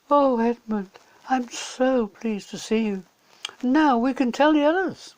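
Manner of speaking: put-on bright, pleased child's voice; Lucy. A narrator's reading.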